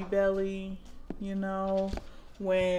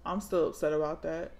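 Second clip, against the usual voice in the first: usual speaking voice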